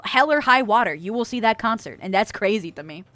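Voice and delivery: ordinary delivery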